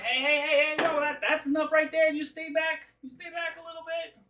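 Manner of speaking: high, loud warning, easing in volume; fussy high-pitched monster holding strangers back; wary creature leader. Acted. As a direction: warn them off in a high creature voice. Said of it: "And the big guy's like".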